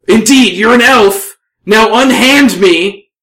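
loud, high demand for release; high-pitched, forceful dwarf shout; indignant dwarf. Acted. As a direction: bellow at your captor as an outraged dwarf. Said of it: "look back at the elf and say".

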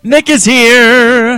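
loud, slow announcement; announcing. Real, not acted. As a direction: call it out slowly. Announcing Nick's arrival.

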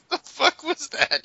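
laughing in disbelief; high, slow, laughing. Real, not acted. Laughing at gibberish question.

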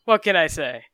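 loud playful flirt; flirty. Acted. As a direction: tease him, loud and coy. Flirty joke at the Commodore.

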